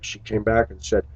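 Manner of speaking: ordinary talk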